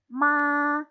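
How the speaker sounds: Ma held high and level; first tone ma. Shown as a demonstration. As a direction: keep Ma high and flat. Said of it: "nada satu".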